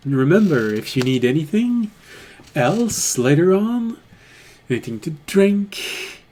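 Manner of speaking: lightly voiced tavern keeper; barkeep voice.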